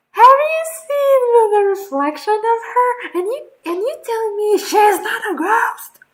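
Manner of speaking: high, loud protest; yelling in disbelief at the story; shouted high-pitched objection; incredulous shout. Real, not acted. Listener protests, excited.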